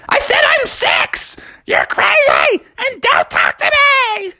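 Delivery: very high, slow angry squawk; crazy old-person voice. A narrator's reading. Girl frog ends the argument with Froggy, crazy old-person voice. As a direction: screech each word in a silly voice. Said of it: "you still talk in that s-, crazy voice".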